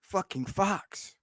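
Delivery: usual speaking voice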